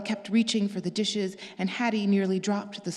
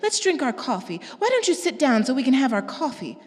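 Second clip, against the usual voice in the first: higher, louder coaxing sister; smooth things over, pitched up; Pearl's voice; 6 semitones higher, 5 dB louder than usual. Acted. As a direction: sister urging everyone to sit, higher.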